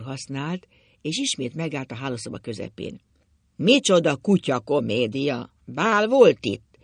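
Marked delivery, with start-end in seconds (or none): 3.6-6.8 loud, slow, drawn-out mocking question; derisive outburst. A narrator's reading. Drunk husband to his wife about the lit rooms, loud and derisive. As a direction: boom it out with rising scorn. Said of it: "És úgy nevetett, hogy köhögött".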